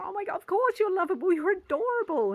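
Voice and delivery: high, loud gushing; gushing over kids. Retold or imitated. Adult imagining little kids, high excited gushing. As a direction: coo at the kids as if delighted.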